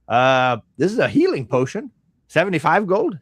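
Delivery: high, loud merchant voice; shopkeeper pitching wares. Acted. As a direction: announce your wares like a shopkeeper. Merchant presents wares to customers.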